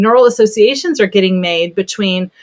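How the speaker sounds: normal talking voice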